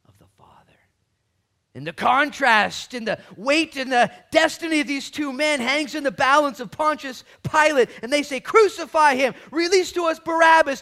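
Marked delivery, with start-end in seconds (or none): 1.7-10.8 high, loud, sustained proclamation; impassioned preacher building the drama; full-force preaching. Acted. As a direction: drive the story home at full voice.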